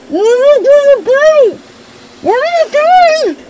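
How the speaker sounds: high, loud, panicked cry, slowed; panicked shriek. Acted. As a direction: shriek in alarm as he fills her up. Being pumped full of helium.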